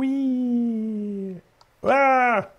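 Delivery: high whooping wee then a sharp cry; yelp of glee. Real, not acted. Player falling off a block, high squealing wee. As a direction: squeal like dropping off a ledge.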